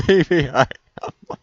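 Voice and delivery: loud laughing words. Real, not acted. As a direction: laugh through it. Laughing at himself.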